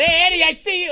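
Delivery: high mock call across a room; retold yell. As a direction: imitate someone calling out a man sneaking in. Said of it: "Patrice yelled".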